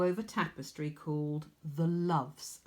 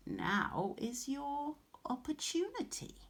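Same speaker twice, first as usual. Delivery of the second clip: ominous crone; 8 semitones higher, 5 dB quieter than usual. A narrator's reading. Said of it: "the old woman said".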